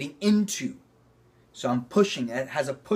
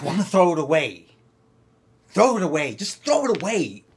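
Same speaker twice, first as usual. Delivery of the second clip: louder and higher, flinging the words away; emphatic throw; 4 semitones higher, 6 dB louder than usual. Shown as a demonstration. Acting teacher demos the throw gesture with an emphatic voice. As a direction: hurl the line away with the whole body. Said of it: "let's play with that".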